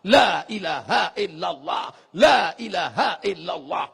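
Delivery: loud, slowed la ilaha illallah; loud zikir. Shown as a demonstration. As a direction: call it out loudly. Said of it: "Itu tadi zikir kuat-kuat".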